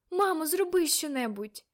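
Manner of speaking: high, loud appeal; urgent plea. A narrator's reading. Girl begging mother to do something, urgent plea. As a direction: plead urgently for help.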